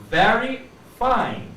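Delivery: slow, exaggerated v in "very fine"; careful v demo. Shown as a demonstration. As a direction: drag out "very fine", teeth on the lip. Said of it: "put your teeth in front of your lower lips".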